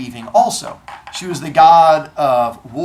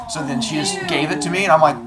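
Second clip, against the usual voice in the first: ordinary talk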